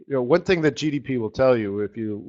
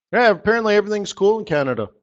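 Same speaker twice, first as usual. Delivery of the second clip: mock cheerful; 8 semitones higher, 5 dB louder, faster than usual. Mock cheerful about Canada.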